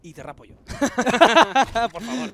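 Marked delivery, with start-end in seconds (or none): 0.7-1.9 loud burst of laughter. Real, not acted. Co-caster laughs at bet.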